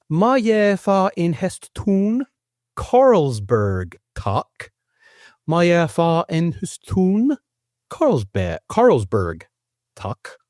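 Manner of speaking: slow, high, drawn-out foreign sounds without accent; deliberately accentless Danish. Shown as a demonstration. Host reading translated Danish beer order, deliberately accentless. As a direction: sound out each unfamiliar word slowly, no accent. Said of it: "with approximately zero accents".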